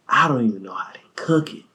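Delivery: quick hushed whisper. Retold or imitated. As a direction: whisper it fast, conspiratorially. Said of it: "He said".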